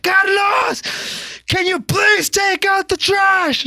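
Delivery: very high, slow, screechy yell; angry mom imitation. Mom interrupting son's online game, angry yelling imitation. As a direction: holler it like a fed-up mother. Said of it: "Why are you so angry?".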